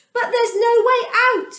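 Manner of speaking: trapped people crying out to Moses; frightened cry. A narrator's reading. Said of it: "they cried".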